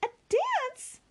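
high, startled "A dance?"; incredulous cry. A narrator's reading. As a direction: she can't believe it. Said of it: "cried Marianne".

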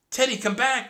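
loud, high desperate call; pleading yell across the herd; crying out. Acted. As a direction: shout after the dog as it runs. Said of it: "cried Annie".

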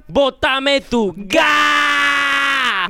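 last syllable held very long; drawn-out battle cry. Pretend. Grita el lema del donante. (grito larguísimo en la última sílaba)

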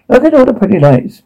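high-pitched distorted character voice; distorted Poker. A narrator's reading. Poker calling attention to the pretty lights, in a high distorted voice. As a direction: read Poker's line up high and strange. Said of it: "Poker distorted".

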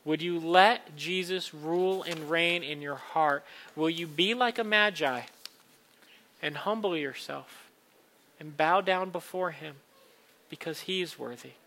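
ordinary talk

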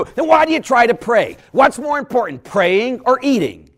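loud, high challenging jab; mock scolding. Priest confronting a man who only tries, mock scolding. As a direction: challenge the excuse sharply.

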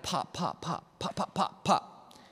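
soft, popping punch noises; hushed staccato sound effects; imitated jabs. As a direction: pop out light, quick jab sounds. Imitates his quick jabs.

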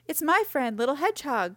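high-pitched cry of recognition; excited cry. A narrator's reading. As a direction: cry out on spotting a friend. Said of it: "cried Rabbit".

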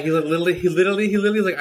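rising excited stammer. Real, not acted.

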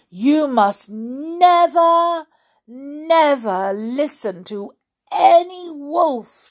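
slow, high, emphatic warning; stern storybook granny, drawn-out; admonishing granny. Acted. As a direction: lecture slowly, leaning hard on each word. Granny warns the girl.

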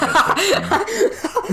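streamer voice. Pretend. Mock streamer voice, laughing.

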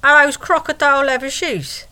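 high, loud and blunt; blunt question. Retold or imitated. Date confronting the stranger on the train, loud and blunt. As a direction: fire the question straight at her. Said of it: "she just bluntly asks this woman".

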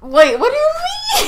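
high, laughing outcry at being caught; laughing shout. Real, not acted. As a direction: yelp in laughing disbelief. Caught out about oil change.

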